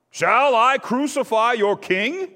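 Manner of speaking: high, loud, slow challenge; taunting question. A narrator's reading. Pilate asks the crowd, high, loud and slow. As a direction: fling it at the crowd. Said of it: "Pilate asked".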